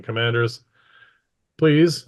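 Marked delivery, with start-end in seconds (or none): 1.6-2.1 mildly raised, higher command; loud voice. A narrator's reading. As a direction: give the order a little raised. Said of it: "in a loud voice".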